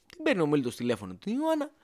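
ordinary talk

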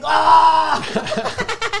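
sudden high angry yell with a laugh. Retold or imitated. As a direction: retell the moment you snapped with a half-laughing yell. Flipping out at workers, retold.

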